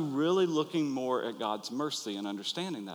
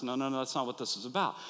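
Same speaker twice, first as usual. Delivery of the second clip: normal talking voice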